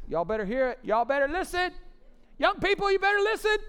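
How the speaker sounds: mock warning rising to a high, loud shout; building yell. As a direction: start insistent and climb into a yell. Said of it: "I just yelled it like that".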